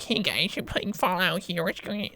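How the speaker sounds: mock his old cringy commentary voice; cringy YouTuber.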